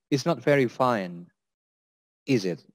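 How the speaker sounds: "is it" sinking at the end; falling tag intonation. Shown as a demonstration. Teacher models small talk on bad weather, falling tag. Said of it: "is slightly going down".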